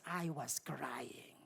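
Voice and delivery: whispered, choked confession; tearful whisper. Real, not acted. Preacher whispers to the congregation that she was crying. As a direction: let the voice drop to a breath.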